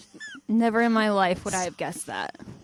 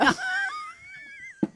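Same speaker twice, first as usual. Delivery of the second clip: high, long wheezing laugh; helpless laughter; 3 dB quieter than usual. Real, not acted. Host cracking up at the Fabio mix-up, helpless laughter. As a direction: burst out in breathless laughter.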